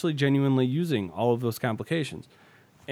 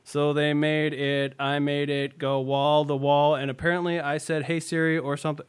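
reading back dictation. Retold or imitated. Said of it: "'cause now it says".

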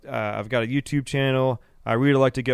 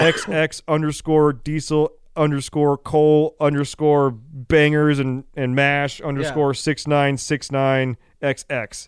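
slow mock recital; slower than usual. Reading a sponsor hopeful's Instagram name, slow mock recital. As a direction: spell out the handle very slowly.

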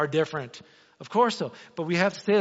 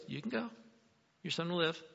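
Jesus voiced; 10 dB quieter than usual. Jesus voiced, far timbre.

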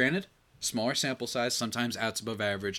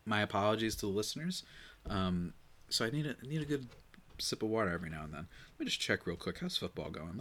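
ordinary talk

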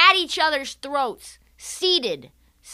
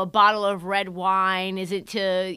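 ordinary talk

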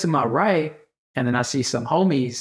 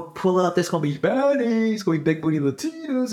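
hyped-up coaxing; 4 semitones higher than usual. Retold or imitated. Talking Caesar into pulling up, hyped-up coaxing. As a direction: coax him with stretched-out words. Hyping up a reluctant friend.

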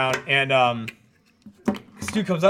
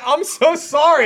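mock apology; 10 semitones higher, 6 dB louder, slower than usual. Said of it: "So I was like".

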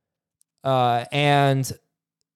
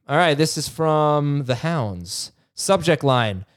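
ordinary delivery

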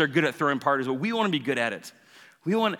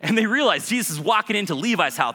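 ordinary talk, nothing marked

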